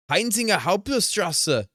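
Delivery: put-on loud, slow, high-pitched German accent; hammed-up German, drawn out and punched; mock German accent. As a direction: read it out in an exaggerated German accent. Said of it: "whenever I do that accent".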